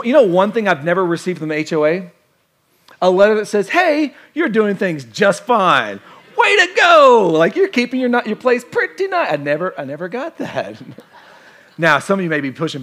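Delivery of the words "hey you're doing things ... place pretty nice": loud, high, over-the-top praise; mock cheerleading. Pastor voicing an HOA letter he never got, hammed-up praise. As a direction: cheer them on like an imaginary fan letter.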